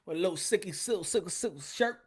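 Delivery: normal talking voice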